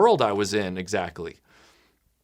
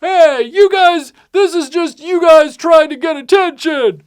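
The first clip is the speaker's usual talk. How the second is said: high, slow holler from a distance; mock yelling; 12 dB louder than usual. Guy yelling from the grass at others, high mock holler. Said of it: "The guy yelling from the grass".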